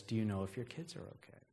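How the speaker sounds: low, hushed menacing phone voice; breathy creepy caller. Acted. Creepy caller phoning the babysitter, breathy and low. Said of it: "It's a breathy voice".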